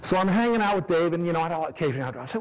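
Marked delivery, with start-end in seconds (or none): none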